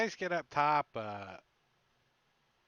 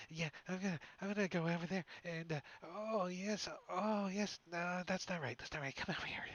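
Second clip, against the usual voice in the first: gibbering woman; 5 semitones higher, 4 dB quieter than usual. Retold or imitated. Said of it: "a female's voice all gibbery".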